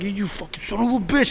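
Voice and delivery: high, sputtering road-rage imitation; mock angry dad. Retelling dad cut off, cursing.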